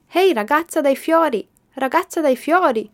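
high, loud call from a window; shouting. A narrator's reading. Boy calls down from the hospital window, high loud shout. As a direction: call out to her from far above. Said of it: "urlò la voce".

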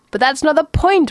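high, exasperated outburst; raging. A narrator's reading. Man turned penguin to calm voice, raging. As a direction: rage at being misunderstood. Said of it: "raged Ford".

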